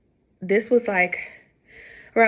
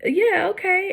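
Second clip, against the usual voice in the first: nurse voice; 12 semitones higher, slower than usual. Retold or imitated. Nurse brushing off twin worry.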